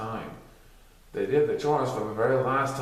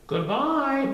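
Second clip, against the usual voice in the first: calling out; 13 semitones higher, 3 dB louder than usual. Acted. Students calling out.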